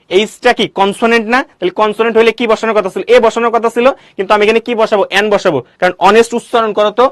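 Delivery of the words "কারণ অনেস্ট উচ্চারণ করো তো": ordinary talk, nothing marked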